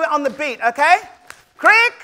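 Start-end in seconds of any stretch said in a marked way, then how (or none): 1.6-1.9 high rising call of crick. Shown as a demonstration. Storyteller calling crick for the kids to answer. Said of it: "when I say crick".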